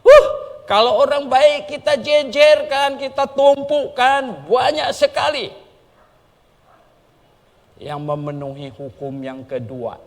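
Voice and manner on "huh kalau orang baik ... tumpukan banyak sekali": high, loud exclaiming from the pulpit; full-force preaching. Acted. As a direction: proclaim it high and loud, slowing on tumpukan. (khotbah berapi-api, tinggi dan keras)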